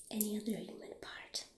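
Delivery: normal talking voice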